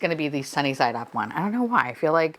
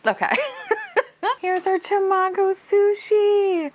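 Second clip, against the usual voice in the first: triumphant squeal; 13 semitones higher, slower than usual. Real, not acted. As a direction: crow about being right. Triumphant reveal, right guess.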